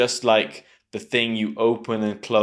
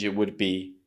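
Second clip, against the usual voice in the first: usual speaking voice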